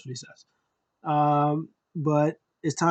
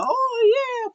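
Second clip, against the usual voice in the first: a very high, stretched-out oh yeah; imitated wrestler cry. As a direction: belt out the entrance cry like a hyped fan. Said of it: "And then the".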